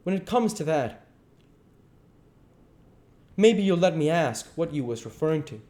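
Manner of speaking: high, pointed comeback; brisk retort. A narrator's reading. Duke throwing the question back at the king, brisk. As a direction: turn the question back on him. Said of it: "pretty brisk".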